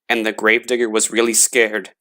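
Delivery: ordinary delivery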